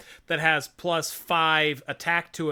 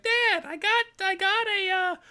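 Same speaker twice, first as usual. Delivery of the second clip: put-on much higher kid voice, eager then let down; young boy; 11 semitones higher than usual. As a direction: play a small boy pleading with his dad. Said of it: "Ben's like".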